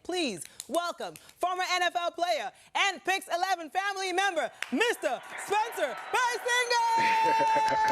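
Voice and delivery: booming announcer build to a shout; fighting game announcer. Acted. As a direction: hype up the name like a ring announcer. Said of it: "How's my announcer voice?".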